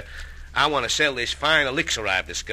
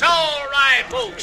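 shouted crowd call; 10 semitones higher, 3 dB louder, much slower than usual. Acted.

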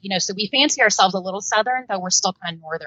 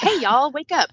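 "Hey, y'all, wake up." called out higher in a hammed-up Southern accent; mock Southern wake-up call; 6 semitones higher than usual. Mom mock-waking her boys with a Southern "Hey, y'all, wake up.". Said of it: "Like, we just don't do that".

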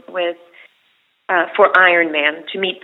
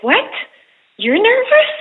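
retold surprise; 13 semitones higher, 5 dB louder than usual. Retold surprise at Kevin Bacon.

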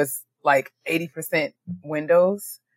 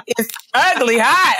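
usual speaking voice

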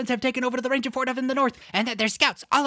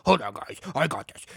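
lightly voiced hero; 7 semitones lower than usual. Voices cool hero Rascal.

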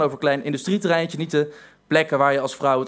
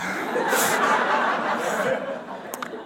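breathy laugh; 4 semitones higher, 4 dB quieter than usual. Real, not acted. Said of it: "laughs at own story".

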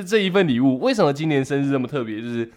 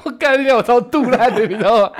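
higher and louder, fed-up cursing; exasperated outburst; 8 semitones higher, 6 dB louder than usual. Real, not acted. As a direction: vent the frustration loudly. Venting his anger. (气到爆粗口)

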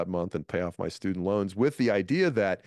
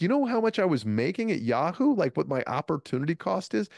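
mock-indignant, pitched up high; retold indignation; 9 semitones higher than usual. Protesting his Yahoo pay to Ralphie May, indignant. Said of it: "I, I was like".

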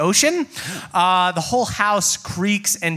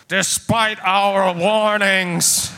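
booming voice; much slower than usual. Acted. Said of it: "you hear a booming voice go".

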